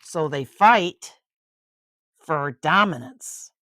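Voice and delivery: storybook narration, lively and clear; bedtime-book reading voice; reading to a child. A narrator's reading. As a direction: read it aloud to a little one.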